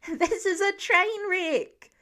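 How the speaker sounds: put-on girl buried in chickens, screeching; screeched. A narrator's reading. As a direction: shriek it from under the hens. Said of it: "Lila screeched".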